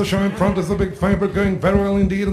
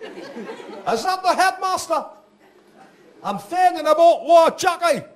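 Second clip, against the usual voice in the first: high, slow Geordie voice with laughs; Geordie caller, first call; 9 semitones higher, slower than usual. Retold or imitated. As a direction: ask in broad Geordie, pitched up. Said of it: "he said".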